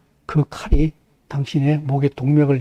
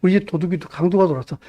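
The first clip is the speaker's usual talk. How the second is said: ordinary talk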